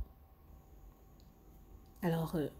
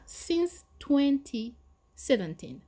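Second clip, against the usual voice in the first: slow model answer; 6 semitones higher, 4 dB louder, slower than usual. Shown as a demonstration. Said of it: "vingt dix-sept, ça sera".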